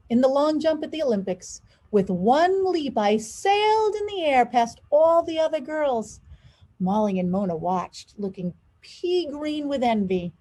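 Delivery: ordinary delivery, nothing marked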